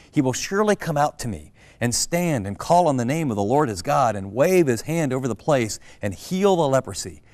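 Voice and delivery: loud, indignant scripture reading; furious Naaman. Acted. Preacher reading the angry king's words to the congregation, furious. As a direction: voice the offended king, raising the volume. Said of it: "Naaman became furious".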